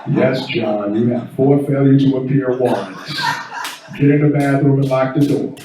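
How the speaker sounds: low, deadpan AI advice; smooth Leon voice. Pretend. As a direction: answer like a laid-back homeboy Alexa. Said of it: "ask Leon".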